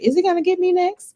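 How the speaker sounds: young people voiced high and anxious; mock worried question. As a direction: ask it like a nervous kid. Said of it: "almost like".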